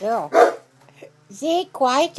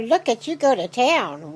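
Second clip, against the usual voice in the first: ordinary talk, nothing marked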